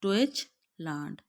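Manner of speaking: teacher models डॉइचलैंड; German tsch sound. Shown as a demonstration. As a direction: say डॉइचलैंड slowly, tsch as one ch. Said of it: "टीएससीएच सिर्फ अकेले एक चे की जो है वो साउंड दे".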